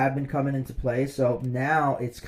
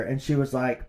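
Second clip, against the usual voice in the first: usual speaking voice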